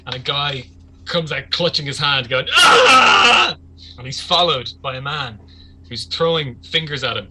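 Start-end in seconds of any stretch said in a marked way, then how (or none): 2.5-3.6 loud, high scream of pain. Acted. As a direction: scream out as if freshly wounded. Injured man bursts out screaming.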